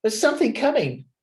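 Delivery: high, anxious plea; a scared voice pleading; frightened plea. A narrator's reading. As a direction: beg to be let in, scared. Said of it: "he begged".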